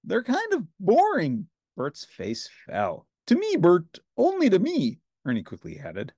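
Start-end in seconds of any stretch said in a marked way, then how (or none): 0.0-1.5 high, bright Muppet Ernie voice; cheerful Ernie, pitched well up. A narrator's reading. Ernie answering Bert, in a high cheerful voice. 3.3-5.0 high, bright Muppet Ernie voice; cheerful Ernie, pitched well up. A narrator's reading. Ernie answering Bert, in a high cheerful voice.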